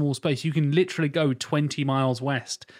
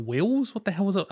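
high-pitched puzzled query; imitated confusion, pitched up; mock bafflement; 8 semitones higher than usual. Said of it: "And we're like".

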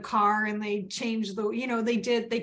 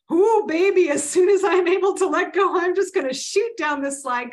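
much higher and louder, gleeful; playful excited cheer; 8 semitones higher, 9 dB louder than usual. Pretend. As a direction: whoop it up to lighten the mood. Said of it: "lighten the mood by going".

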